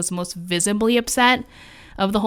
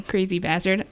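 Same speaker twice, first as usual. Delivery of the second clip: ordinary talk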